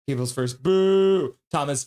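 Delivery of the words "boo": loud, higher fan boo; drawn-out boo. Retold or imitated. Host reading out a viewer's boo, booing. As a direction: boo like a crowd heckler.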